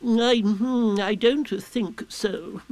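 high, slow, hesitant owl; gravely pondering Owl. A narrator's reading. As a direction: ponder it ponderously before refusing. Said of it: "said Owl after grave thought".

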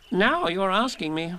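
high and slow, pleased with himself; Rabbit voice. Acted. Rabbit character voice.

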